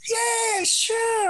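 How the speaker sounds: high mock eager agreement. Promising Michael a night out, high eager yes. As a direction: squeak out a keen yes.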